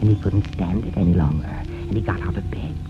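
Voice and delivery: usual speaking voice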